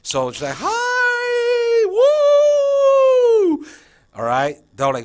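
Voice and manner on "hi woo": piercing high, loud, long whoop; over-the-top excited greeting. Shown as a demonstration. Teacher grabbing full attention of small kids, wildly excited. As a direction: squeal hi and let the woo soar. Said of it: "something simple".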